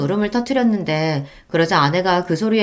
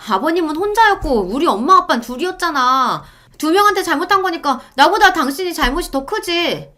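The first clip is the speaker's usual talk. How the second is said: higher, insistent wife arguing; wife's voice; 10 semitones higher than usual. Acted. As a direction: press the point like a stubborn wife. Wife argues back. (높은 목소리로 우기는 아내)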